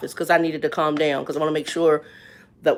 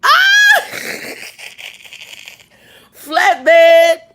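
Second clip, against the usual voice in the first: sudden high loud yelp then laughing; shriek, then break into laughter; startled shout; 11 semitones higher, 9 dB louder than usual. Real, not acted. Startled mid-story, a loud high shriek.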